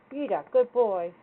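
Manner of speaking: loud, fast gushing praise; excited praise. Real, not acted. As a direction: burst out praising the dog.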